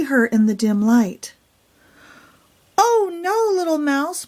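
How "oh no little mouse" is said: high alarmed call. Acted. Hen calling down from the roost to warn the mouse, high. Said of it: "Pumpkin called from the roost".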